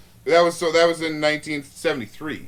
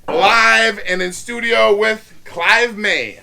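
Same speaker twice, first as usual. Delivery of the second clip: announcer intro; 7 semitones higher, 9 dB louder than usual. Acted. Announcing the show and guest.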